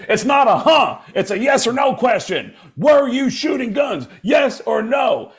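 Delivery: high, forceful mock scolding; exasperated cop. Cop demands a straight answer from suspect, exasperated shouting. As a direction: hammer each question at a dim suspect.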